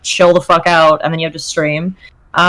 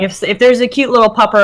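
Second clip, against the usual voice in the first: usual speaking voice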